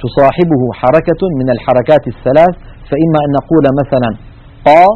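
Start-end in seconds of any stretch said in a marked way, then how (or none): 4.7-4.8 قا with the ق followed by an open a vowel; قا as ق plus a clear fatha a; open qaf demo. Shown as a demonstration. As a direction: say قا with the mouth opening on a. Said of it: "فتكون القاف مفتوحة".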